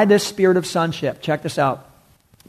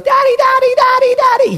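piercing, very high loud squeal; child's shriek; 6 dB louder than usual. Shown as a demonstration. Preacher shows what the cry word means, shrieking like a child. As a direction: shriek it like an excited little kid. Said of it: "The cry word means a shriek, like a little kid goes".

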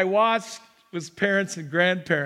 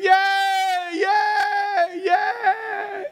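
much higher, loud mock yells of yay; screaming cheer; 12 semitones higher, 4 dB louder, much slower than usual. Pastor imitating the mother screaming for her boy, high mock yells. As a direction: cheer like an overexcited sideline mom. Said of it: "She's screaming".

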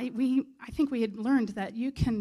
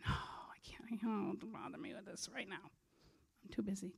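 acted weary refusal, much softer; hushed brush-off; 10 dB quieter than usual. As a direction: wave them off under your breath. Acts out someone brushing others off.

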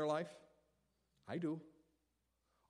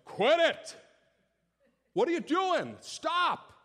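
loud sharp father's shout; father yelling; 14 semitones higher, 11 dB louder, slower than usual. Acted. Father shouting at kids fighting in the bedroom. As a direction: yell at the kids to stop. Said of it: "a good father raises their voice".

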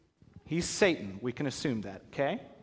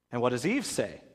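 normal talking voice

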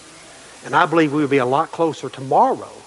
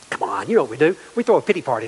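ordinary talk, nothing marked

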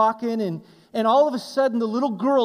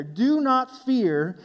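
slow emphatic quote; 2 semitones higher, much slower than usual. Shown as a demonstration. Stretched scripture quote.

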